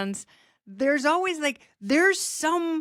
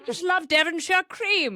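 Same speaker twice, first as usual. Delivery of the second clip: higher, hammed-up fake British accent; 5 semitones higher than usual. Host mocking Stuart Varney's fake British accent, hammed up. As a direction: play up a put-on posh British voice. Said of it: "Stewart say it in his fake accent".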